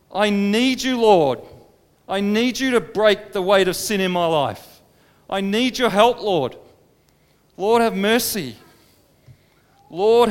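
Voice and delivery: loud, pleading prayer; crying out for mercy. Acted. Tax collector crying out to God for mercy, loud and desperate. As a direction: beg God for help with all your strength. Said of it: "He cries out for mercy".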